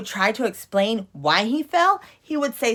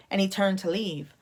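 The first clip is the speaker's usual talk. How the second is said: ordinary delivery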